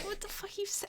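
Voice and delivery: high, hushed, half-laughing disbelief; quiet baffled reaction. Retold or imitated. Friend retells hearing the odd word, quiet baffled reaction. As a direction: squeak it very softly through a laugh.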